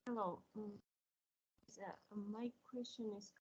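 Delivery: very faint, distant voice; speaking far too softly into the mic; barely audible. Real, not acted. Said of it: "Sorry, your voice is very low".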